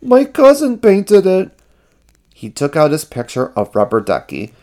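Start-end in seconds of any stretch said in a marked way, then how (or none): 0.0-1.5 high, loud, upset confession; high character voice in mock tears; tearful cry. A narrator's reading. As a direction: blurt it out, pitched way up. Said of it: "he cried".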